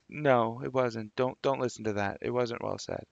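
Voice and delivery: quiet self-correcting mutter; embarrassed aside. Real, not acted. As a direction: pull it back under your breath. Muttered aside retracting his boast.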